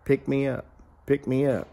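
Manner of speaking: soft, lightly voiced repeated plea; a hint of a small hidden creature; mysterious little voice. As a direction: quietly imitate an unseen creature calling. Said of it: "Noise kept saying".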